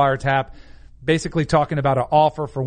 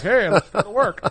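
higher, lightly put-on chipper professional; 5 semitones higher than usual. Shown as a demonstration. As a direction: parody a square professional, half laughing.

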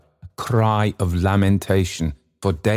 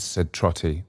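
plain reading voice

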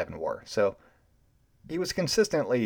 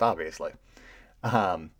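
usual speaking voice

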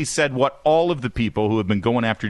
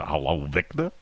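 softer put-on New York greeting; lower, quieter New York accent; 6 semitones lower, 5 dB quieter than usual. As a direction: toss off a mock New York hello. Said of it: "I'm assuming Victor's in New York".

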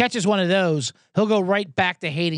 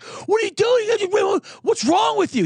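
much higher, slow, shouted outrage; furious shout; 3 dB louder, slower than usual. Retold or imitated. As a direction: bellow at the passenger for touching the trunk. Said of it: "he gets out furious".

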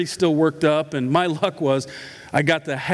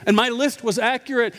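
normal talking voice

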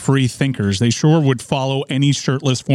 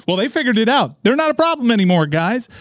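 breezy mock bro reassurance, pitched higher; lightly voiced podcast host, quick and higher; Joe Rogan; 7 semitones higher than usual. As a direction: toss it off like a podcast host vouching for a sponsor. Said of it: "just have Joe Rogan say on his show".

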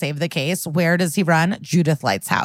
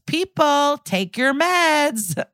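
high, drawn-out mock call to order; exasperated judge; 10 semitones higher, much slower than usual. Judge gavels at the whole courtroom, high slow mock exasperation. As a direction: imitate a fed-up judge, stretching each word up high.

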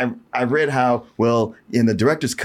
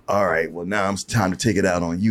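menacing priest; 7 semitones lower than usual. Retold or imitated. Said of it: "he's like".

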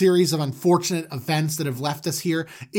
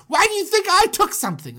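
much higher, fast defensive whine; whiny defensive; 14 semitones higher, faster than usual. Retold or imitated. Judah fending off his brothers' suspicion, high fast whine. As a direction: whine back, fast and indignant. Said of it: "He's like".

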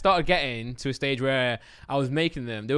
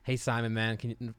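put-on low, soft, tentative American accent; half-hearted American accent, held back; attempted American accent; 4 semitones lower, 6 dB quieter, slower than usual. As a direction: try out an American accent, unsure. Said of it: "I gotta hear it out before I start saying it".

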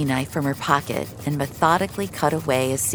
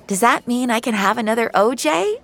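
put-on high, bright-eyed kid's question with a grin; eager child; 9 semitones higher, 4 dB louder than usual. Girl to waitress about more juice, eager. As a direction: ask hopefully like a little girl.

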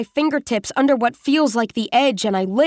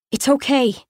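ordinary delivery, nothing marked

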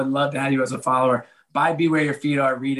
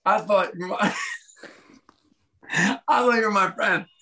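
flustered laughing apology; 8 semitones higher than usual. Retold or imitated. Embarrassed apology to commissioner.

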